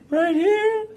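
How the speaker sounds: very high, slow squeaky alarm; hammed-up squeal of fear; panicked warning. Sister warning about the bear, panicked squeal.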